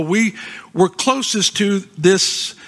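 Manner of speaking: normal talking voice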